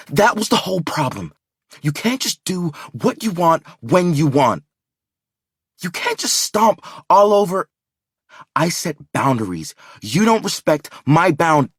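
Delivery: high, loud rage, words garbled; raging. A narrator's reading. Furious girlfriend out of breath, raging at him. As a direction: spit it out in fury. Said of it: "the rage in her mouth, garbling her words".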